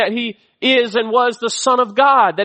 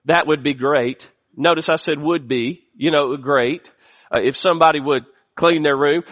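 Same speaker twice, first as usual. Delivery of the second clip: wry aside; 6 semitones lower than usual. Real, not acted. Wry wishful aside to parents.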